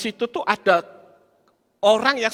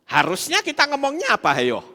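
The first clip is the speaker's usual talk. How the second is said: ordinary talk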